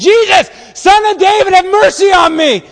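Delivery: full-force, high-pitched desperate yell; belted scream. Acted. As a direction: scream it at the top of your lungs. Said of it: "belted out of the top of his lungs".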